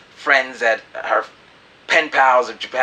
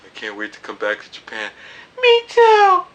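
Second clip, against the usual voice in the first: much higher, squeaky imitation of her; mock her in a high giggly voice; girly voice; 5 dB louder than usual. The Japanese girl agreeing they're a good team, in a high squeaky voice. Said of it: "And she's like".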